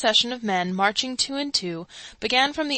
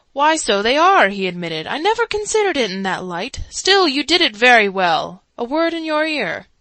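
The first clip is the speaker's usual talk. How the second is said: louder, higher sly agreement; louder, higher crafty voice; crafty Sub-Warden; 4 semitones higher, 7 dB louder than usual. A narrator's reading. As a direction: agree slyly then lean in. Sub-Warden agrees, then confides.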